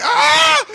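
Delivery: sharp high frightened shriek, loud; panicked scream. Real, not acted. Bird lunges at the man helping it, loud panicked shriek. Bird startles him again.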